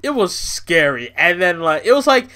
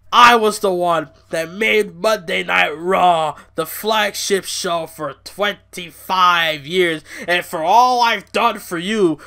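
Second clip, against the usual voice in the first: loud, mocking boss rant, higher; mock Vince rant; 3 semitones higher, 5 dB louder than usual. A YouTuber mocks Vince McMahon scolding the crowd, loud and hammed-up. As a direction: ham up an ungrateful chairman's gloating. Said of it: "And he's like".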